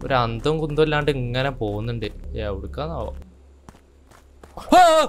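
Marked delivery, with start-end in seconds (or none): 4.6-5.1 sudden high, loud shriek of terror; a startled scream, full voice; real scream of fright. Real, not acted. Jumpscare by a bear.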